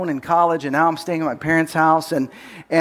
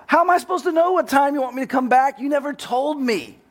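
much higher, fast retold yell; yelling back; 8 semitones higher than usual. Son yelling back at his father, higher mock yell. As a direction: fire it back at Dad, pitched up. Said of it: "I start yelling at him".

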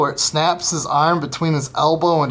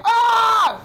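put-on hugely higher yelled scream of a hurt kid; imitated scream of pain. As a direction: scream like the little brother who fell off the roof. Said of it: "he was like".

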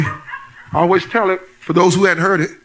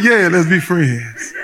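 laughing; 4 semitones lower, much slower than usual. Retold or imitated. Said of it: "And I was like".

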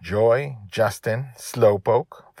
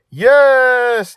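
high, loud, stretched-out cheer; whooping, full-voiced agreement; excited yell; 16 dB louder than usual. A narrator's reading. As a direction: shout it like a child bouncing in the seat. Child jumps up and down, thrilled.

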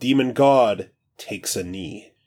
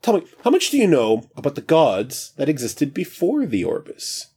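high, breezy, carefree god; casual new voice; 6 semitones higher, faster than usual. Acted. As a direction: stretch and chat like just woken. God questions Parker.

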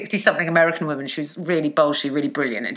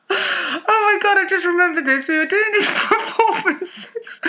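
high, slow words squeezed through laughing; helpless laughter; 13 semitones higher, slower than usual. Real, not acted. Friend suddenly remembers student performances, helpless high-pitched laughing. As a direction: talk while cracking up, pitch way up.

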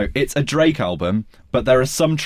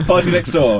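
bingo caller laughing; 5 semitones higher than usual. Retold or imitated. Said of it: "like a bingo caller".

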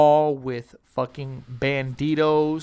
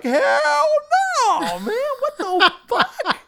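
very high, loud laughing refusal; mock shouted refusal; 6 dB louder, slower than usual. Refusing to confront bikers, very high laughing shout. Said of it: "I'm like".

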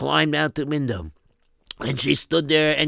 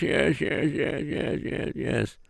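softer and softer, down to a whisper; fading away; 3 semitones lower, 8 dB quieter, slower than usual. Acted. Mother's voice fading out of the dream, whispered. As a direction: let the yeses fade into breath.

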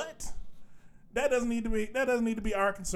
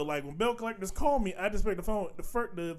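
usual speaking voice

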